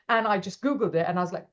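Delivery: normal talking voice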